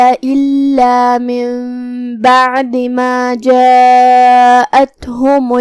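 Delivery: slow, chanted tilawat with held vowels; Quran recitation. Acted. Teacher reciting verses for students in a slow melodic chant.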